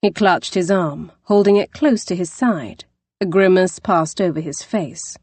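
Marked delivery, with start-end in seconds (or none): none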